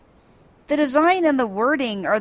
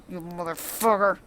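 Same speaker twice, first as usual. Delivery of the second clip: softer, teeth-clenched curse; muttered through clenched jaw; 3 semitones lower, 6 dB quieter than usual. Retold or imitated. Briardine fuming at Hildebrand, softer through clenched teeth. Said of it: "he just clenches it real like".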